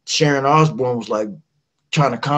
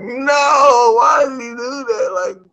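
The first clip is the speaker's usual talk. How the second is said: outraged West Virginia folks; 11 semitones higher than usual. Retold or imitated. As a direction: shriek like a hometown crowd mortified by you. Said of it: "But then West Virginia would have been like".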